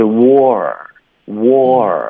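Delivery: "war, war" very slow, wo plus an r colour. Shown as a demonstration. Teacher demonstrates the syllable, very slow "war". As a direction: stretch each "war" into a long r-coloured vowel. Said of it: "带一个儿化音啊".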